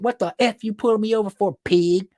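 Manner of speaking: high, loud indignant demand; mock cussing out. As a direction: imitate an angry woman telling off police. Voicing woman cussing out officer.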